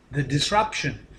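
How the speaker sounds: normal talking voice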